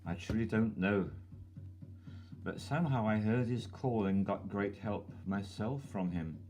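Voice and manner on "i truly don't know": ordinary talk, nothing marked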